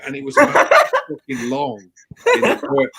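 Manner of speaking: high, loud, laughing through the words; can barely get it out for laughing. Real, not acted. Telling a mate about the toilet, laughing. Laughing while describing huge turd.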